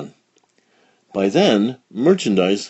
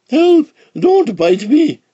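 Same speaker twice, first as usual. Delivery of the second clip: scream; 7 dB louder, slower than usual. A narrator's reading. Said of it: "screamed the mailman".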